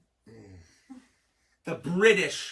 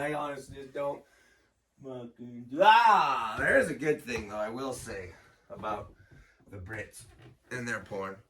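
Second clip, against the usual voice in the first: ordinary talk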